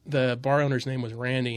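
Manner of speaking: usual speaking voice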